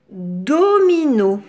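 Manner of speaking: "dominos" slow, in three even beats; syllable by syllable. Shown as a demonstration. Teacher introducing the word to children, "dominos" slowly syllabified. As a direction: stretch each syllable of "dominos". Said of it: "Trois syllabes".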